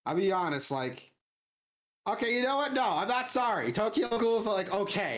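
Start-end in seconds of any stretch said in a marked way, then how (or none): 2.1-5.2 worked-up rant. Real, not acted. Heated rant about Tokyo Ghoul.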